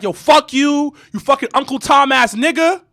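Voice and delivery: imitated angry insult, pitched high; getting loud. Door woman cussing out a customer, getting loud. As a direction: act out her loud outburst. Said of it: "She starts getting loud with me".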